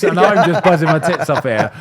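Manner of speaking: laughing while telling it; giggling through the words. Real, not acted. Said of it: "laughing my head off".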